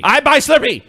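loud, high mock broken English; imitating broken English. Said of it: "trying to talk his level of English".

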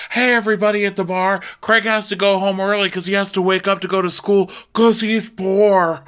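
high, loud and fast to the room; teasing a friend in front of everyone, loud and high; mock announcement. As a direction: call it out to the whole bar.

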